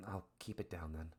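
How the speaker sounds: soft, subdued reply; hushed, shaky resolve. Acted. Daughter agrees to keep it down for her father, hushed shaky resolve. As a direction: answer softly, keeping it down. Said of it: "you can hear a shakiness in her voice".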